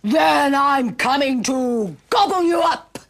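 loud, drawn-out menacing troll; roaring troll. Acted. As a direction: roar the threat as a hungry troll. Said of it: "roared the troll".

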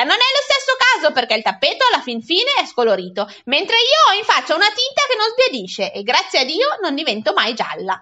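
high-pitched, huffy comeback; offended sister defending herself; piqued. A narrator's reading. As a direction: answer back stung, swooping up on the key words. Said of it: "rispose piccata mia sorella".

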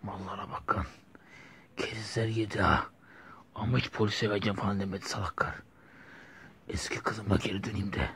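whispered sneer at the fools; sly whispered gloat. Acted. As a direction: hiss it to yourself in triumph. Villain gloating alone.

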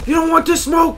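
very high, loud taunt; high-pitched trash-talk holler; taunting shout. Real, not acted. Taunting an opponent in game.

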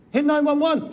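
high frantic yell of hit nine one one; a desperate cry for rescue, high and loud; panicked shout. Acted. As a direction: scream the number out in panic. Trapped workers panicking in fire.

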